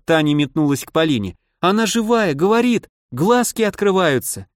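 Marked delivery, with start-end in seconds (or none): 1.6-4.5 put-on excited girl. A narrator's reading. Girl excited about living doll.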